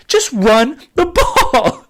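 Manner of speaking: laughing plea. Real, not acted. Laughs at his own plea.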